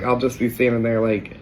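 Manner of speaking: normal talking voice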